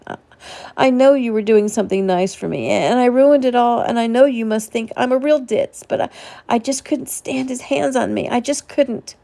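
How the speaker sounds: high, tearful, words tumbling out; a woman breaking down in front of a kind man; distraught outburst. A narrator's reading. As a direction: pour it all out near tears. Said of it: "flood of jumbled emotions came tumbling out".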